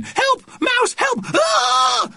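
very high shrieks for help; panicked screaming. A narrator's reading. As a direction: shriek in comic mass panic. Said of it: "cried the other women".

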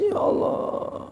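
breathy, high whisper as if weeping; a hint of sobbing in a hushed plea; tearful whispered prayer. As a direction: imitate a young man praying through tears. Youth praying in tears, imitated.

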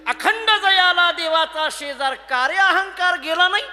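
loud, high, drawn-out challenge; ringing reproach, slow and forceful; stern rebuke. Acted. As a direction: fire the question out as a scolding. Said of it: "मुक्ताबाईंनी खडसावलं".

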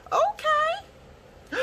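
very high delighted squeal; squealed okay. Real, not acted. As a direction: let it squeak out in joy. Delighted squeal after laugh.

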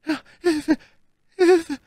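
very high, gasped plea; groaning for help. A narrator's reading. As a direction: groan it out as a dying woman. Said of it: "ächzte Madame Bonacieux". (hoch geächzter Hilferuf)

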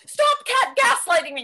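angry shout. Acted. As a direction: shout back at her accusation. Arguing with girlfriend.

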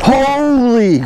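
long, loud, high-pitched exclamation of shock; astonished shout. Real, not acted. Angler stunned by the huge catfish, astonished shout.

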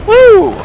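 high joyful whoop; excited whoop. Real, not acted. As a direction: cheer out loud. Celebrating in game.

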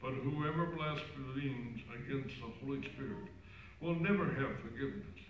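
cathedral echo. Real, not acted. Gospel read far off.

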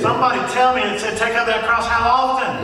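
preacher whipping up the congregation's answer; rousing call and response. Shown as a demonstration. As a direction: demand they shout it back. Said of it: "That sounds a little better".

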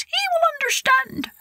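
very high little king's voice; high-pitched mouse ruler. A narrator's reading. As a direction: assure them as a tiny mouse monarch. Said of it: "said the King of the Mice".

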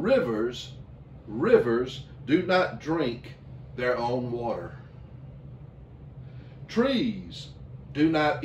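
slow recitation with long, weighty pauses; measured narrator voice. Retold or imitated. Pastor reciting an inspirational video's words to the congregation, slow and solemn. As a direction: deliver each phrase slowly, letting it settle. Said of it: "this man in this low monotone voice said these words".

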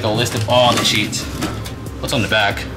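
usual speaking voice

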